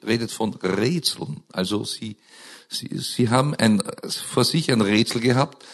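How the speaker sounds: ordinary talk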